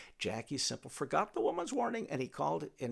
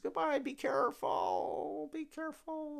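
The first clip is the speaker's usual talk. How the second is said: put-on old woman's farewell; 12 semitones higher than usual. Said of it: "the old woman says".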